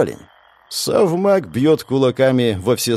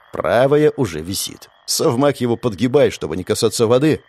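ordinary delivery, nothing marked

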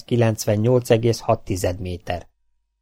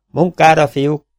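loud, higher rallying call; shouted command; 6 semitones higher, 7 dB louder, slower than usual. A narrator's reading. Captain rallies his crew to work, shouting loudly. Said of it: "kiáltotta Zénó kapitány".

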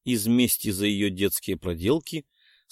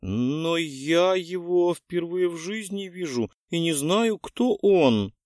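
small frightened voice; 7 semitones higher, slower than usual. A narrator's reading. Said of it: "слабым тоненьким голоском испуганной маленькой девочки".

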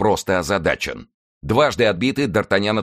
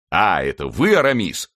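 surprised exclamation. A narrator's reading. Porthos recognises Aramis, surprised exclamation. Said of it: "воскликнул Портос".

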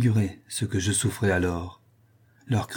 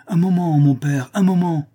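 high, louder, hurried-sounding call; calling back; 8 semitones higher, 5 dB louder than usual. A narrator's reading. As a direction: call back to put father off. Son calling back to impatient father.

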